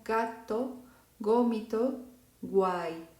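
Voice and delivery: gato, gómito, guay with a hard g sound; Italian hard g. Shown as a demonstration. Teacher models the Italian hard G for students. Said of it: "vamos a pronunciar las palabras como".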